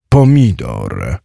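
low, very slow giant voice; groaning giant. A narrator's reading. Giant answers the next silly question, groaning. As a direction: drag „Pomidor” out in a low, weary voice. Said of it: "stęknął gigantyczny strażnik skarbu".

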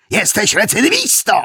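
high-pitched accusing cry; roared accusation. A narrator's reading. Westfield presses the cornered suspect as a repeat offender, roared accusation.

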